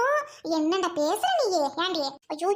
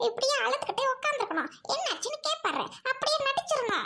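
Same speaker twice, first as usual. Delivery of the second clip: hushed, higher plotting voice; daughter-in-law plotting to split the family, hushed; scheming aside; 4 semitones higher, 3 dB quieter than usual. Acted. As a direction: scheme under your breath.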